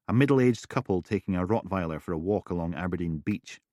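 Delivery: usual speaking voice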